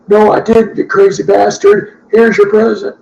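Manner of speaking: hammed-up retort with a present; voicing Vince. Voicing Vince in wrestling bit.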